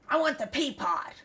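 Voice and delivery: loud, high-pitched demand; imitating a father bellowing for help; retold yelling. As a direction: holler it like a cranky patient.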